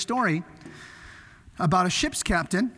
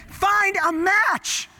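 slow, much higher shouted order; urgent shout; 10 semitones higher, 4 dB louder, much slower than usual. Retold or imitated. Sailors shouting at each other for a match. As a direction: stretch out the desperate call. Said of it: "And they're".

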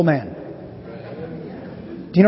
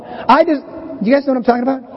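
normal talking voice

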